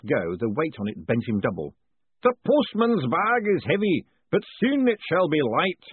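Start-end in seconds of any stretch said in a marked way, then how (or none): 2.2-5.8 slow, booming ritual master. A narrator's reading. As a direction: boom it out like a grand master of ceremonies. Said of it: "echoed off the walls".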